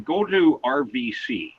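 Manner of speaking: normal talking voice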